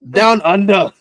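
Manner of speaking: high, loud mock-Australian; exaggerated Australian catchphrase; Australian accent. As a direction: chime in with a hammed-up Aussie accent. Joking along with Australian accent demo.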